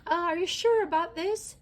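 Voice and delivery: high, loud, jittery question; doubting the climb, voice tight; nervous. A narrator's reading. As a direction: Jessie nervous climbing down by the landing gear. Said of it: "Jessie asked nervously".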